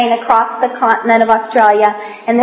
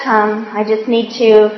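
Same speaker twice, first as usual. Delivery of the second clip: ordinary talk, nothing marked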